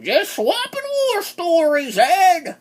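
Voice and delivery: high, slow put-on character voice; drawn-out high-pitched mimicry; mock Mandria voice. Reviewer imitating Mandria confronting Ed, high and drawn out.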